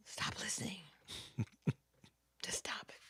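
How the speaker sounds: breathy whispered aside. Real, not acted. The speaker signing off a riff to her co-host, whispered. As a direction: hush it to a playful whisper. Soft aside after a rant.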